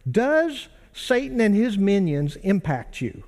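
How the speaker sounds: ordinary talk, nothing marked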